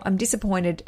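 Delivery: ordinary talk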